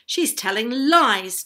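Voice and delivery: angry shout. A narrator's reading. Tom accusing Arabella of fibbing, shouting angrily. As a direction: shout it in indignation. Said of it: "Tom shouted angrily".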